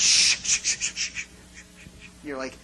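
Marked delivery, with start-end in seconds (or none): 0.0-1.3 rapid string of sharp shushes; imitated parent's frantic shh; urgent shushing. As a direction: hush a noisy baby in church. Parent hushing noisy baby in church.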